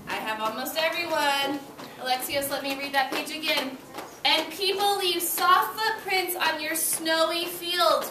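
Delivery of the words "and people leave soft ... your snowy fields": rereading aloud. A narrator's reading. Teacher rereading a page to her class, slow and clear. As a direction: give each word to the class slowly.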